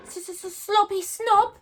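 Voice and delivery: high, slow, loud stammering dragon taunt; calling out taunt. A narrator's reading. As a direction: jeer it across the sky. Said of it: "Toothless called out".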